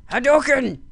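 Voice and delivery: high, loud battle cry; attack call. Pretend. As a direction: shout it like a fighting-game special move. Calls out a game attack.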